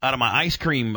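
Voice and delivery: normal talking voice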